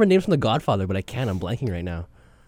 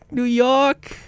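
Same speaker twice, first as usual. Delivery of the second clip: high, put-on New York accent; Bill Burr-like accent; 9 semitones higher, 3 dB louder than usual. Host trades New York shouts with co-host, mock accent. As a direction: holler it like an East Coast comic. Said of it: "It sounds like Bill Burr".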